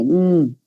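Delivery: short hummed "mm" with closed lips; hummed M sound. Shown as a demonstration. Teacher demonstrates the letter M, humming it briefly. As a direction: hold the bilabial M as a hum. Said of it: "Y la M".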